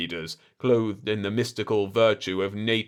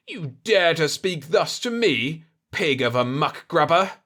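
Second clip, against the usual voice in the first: louder, higher outraged challenge; outraged potentate; 7 semitones higher, 5 dB louder than usual. Acted. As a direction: bristle with wounded pride. Potentate insulted, enraged.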